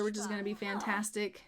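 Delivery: ordinary talk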